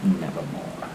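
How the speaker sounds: low, hushed, ominous croak; raven's reply. Acted. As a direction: drop deep and soft for the raven's one word. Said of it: "Quoth the raven".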